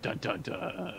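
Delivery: whispered mock dramatic sting; breathy ominous sound effect; dramatic dun dun dun. Dramatic sting after nerf list.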